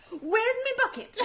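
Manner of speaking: put-on very high toddler voice with Irish accent; Irish-sounding child. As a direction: imitate a little girl with an Irish lilt. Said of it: "sound like she's Irish".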